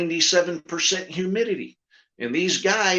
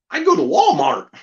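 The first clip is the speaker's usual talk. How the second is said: high puppet voice; 10 semitones higher, 6 dB louder than usual. Retold or imitated. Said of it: "what do you do".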